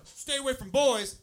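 loud, high mock fatherly order; stern dad warning. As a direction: lay down the law to a teenage daughter, slowly. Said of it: "when you say to your teenage daughter".

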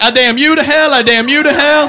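high loud mock curse; God on Maury damning the crowd to hell, loud. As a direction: hurl the curse like a ham god. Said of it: "he's like".

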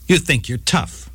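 plain reading voice

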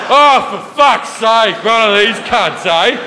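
broad Aussie grumble. Retold or imitated. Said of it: "They're like".

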